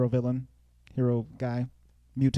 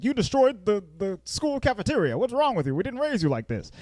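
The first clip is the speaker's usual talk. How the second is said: higher-pitched imitation of scolding; mock mad parents; 7 semitones higher than usual. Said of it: "they're mad at him".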